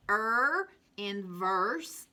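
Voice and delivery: Er in verse said slowly, er drawn out and rising; slow phonics drill. Shown as a demonstration. Teacher modelling er in verse for children. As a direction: stretch the er so kids hear it. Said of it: "phonics drill for the class".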